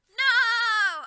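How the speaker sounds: very high, very loud shriek; scream. A narrator's reading. Ebony refusing Satan's blackmail, piercing scream. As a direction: shriek the word in horror. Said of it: "I screamed".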